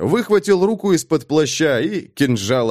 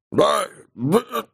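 choked gurgle with spear at throat; gurgling voice; 9 semitones higher than usual. Acted. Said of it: "пробулькал всадник".

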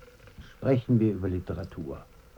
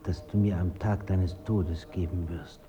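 ordinary talk

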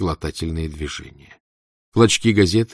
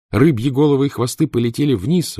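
usual speaking voice

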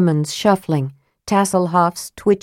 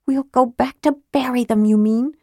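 higher, tart retort; bitter young woman; 7 semitones higher than usual. A narrator's reading. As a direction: bite back with bitterness.

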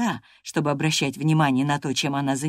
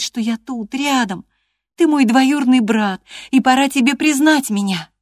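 Catherine's voice; 7 semitones higher, 6 dB louder than usual. Acted. Catherine pleading with Hareton.

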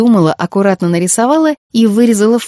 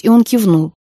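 ordinary delivery, nothing marked

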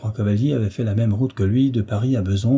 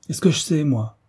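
mildly irritated retort, starting high; ill-humoured; 6 semitones higher than usual. A narrator's reading. Replying to where they will go, mildly ill-humoured. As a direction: answer back with a touch of temper. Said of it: "répondit vif Argent avec humeur".

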